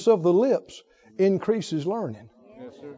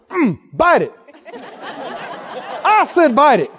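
mock greedy fish, slow; playful fish voice; lightly voiced hungry fish; imitating a fish; 7 semitones higher, 4 dB louder, much slower than usual. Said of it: "take the bait and go".

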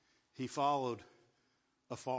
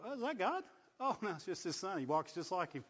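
surprised onlookers; 6 semitones higher than usual. Retold or imitated. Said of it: "they're like".

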